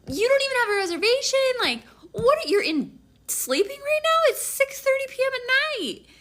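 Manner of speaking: loud, high-pitched incredulous rant; annoyed. Retold or imitated. Woman to boyfriend without a reservation, annoyed. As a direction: pile up exasperated questions. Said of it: "I started getting annoyed".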